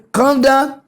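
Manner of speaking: high, teasing calm down; mock gym instructor. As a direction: coo it in mockery. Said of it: "they go say".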